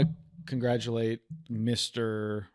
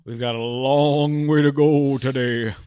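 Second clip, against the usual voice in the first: slow, loud dramatic line; mock dramatic gasp line; 3 semitones higher, 8 dB louder than usual. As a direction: deliver it like a shocked narrator. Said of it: "I went".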